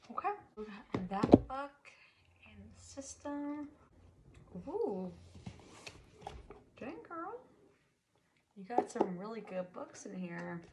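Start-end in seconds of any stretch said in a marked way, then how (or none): 4.7-7.4 impressed surprise. Real, not acted. Impressed by book collection.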